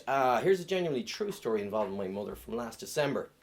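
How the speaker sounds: ordinary talk, nothing marked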